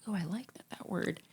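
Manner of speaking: hushed whisper of delight at a word; whispered thought. Retold or imitated. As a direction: breathe it out like a private thought. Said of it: "where it highlights a word that I'm like".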